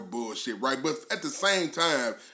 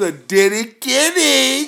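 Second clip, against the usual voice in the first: hype shout; 8 semitones higher, 6 dB louder, slower than usual. Real, not acted. Co-host repeats guest's handle.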